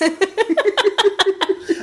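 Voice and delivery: high burst of laughter. Real, not acted. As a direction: laugh at yourself. Laughs at own joke.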